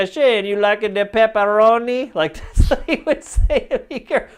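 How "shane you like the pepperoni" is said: slow, higher hammed-up Italian accent; imitating boss's Italian accent. Imitating the Greek pizza boss in a hammed-up Italian accent. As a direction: play the old pizza boss, drawn out. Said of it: "But he'd be like".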